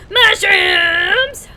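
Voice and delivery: high, loud delighted cry; a real squeal of joy; excited. Real, not acted. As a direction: burst out in glee at the find. Spots mushrooms in the game.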